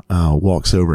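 ordinary talk, nothing marked

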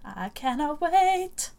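high, slow and eager; excited. Real, not acted. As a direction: savour the anticipation. Excited about festival.

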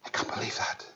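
put-on scandalised young woman, breathy hush; hushed, appalled church girl; shocked young woman. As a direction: whisper it as if appalled. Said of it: "She says".

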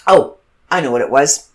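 normal talking voice

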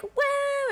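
high, sustained imitated "woo"; crowd cheer. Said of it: "everyone was like".

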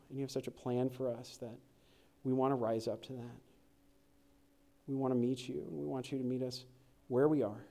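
soft, low prayer to the Father; quiet prayer. Acted. Pastor praying softly for the congregation, quiet and low. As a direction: pray gently over the morning.

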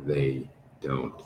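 low, soft, sinister taunt; menacing Gareth. Acted. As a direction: murmur it down low like a villain twisting the knife.

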